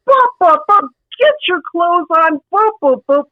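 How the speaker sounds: high, sputtering imitation of the upset dad; dad's sputtering. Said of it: "he was freaking out".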